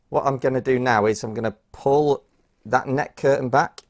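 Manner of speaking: ordinary talk, nothing marked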